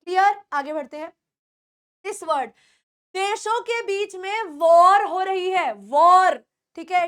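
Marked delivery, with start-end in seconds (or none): 4.6-6.4 "वॉर" with a rounded aw; correct pronunciation. Shown as a demonstration. Teacher models वॉर between countries, correct pronunciation. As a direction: hit वॉर loud on a rounded O. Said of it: "It is not war".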